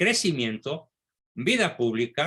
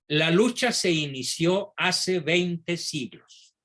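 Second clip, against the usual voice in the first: slow, measured quotation; deliberately slow; 3 semitones higher, slower than usual. Shown as a demonstration. Lecturer quoting a speech for listeners to absorb, deliberately slow. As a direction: read it out slowly so every word sinks in. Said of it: "la voy a decir despacito".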